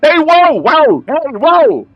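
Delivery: high, loud shout of whoa; shouting cop. Pretend. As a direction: yell at them to stop. GM voices cop stopping trespassers.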